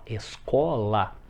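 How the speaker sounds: escola drawn out syllable by syllable; slow sounded-out example. Shown as a demonstration. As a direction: stretch escola slowly so each vowel is heard. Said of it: "Exemplo, ó".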